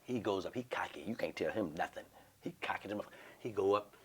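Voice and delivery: soft unvoiced aside; hushed storytelling; whisper. Real, not acted. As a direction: whisper it like gossip.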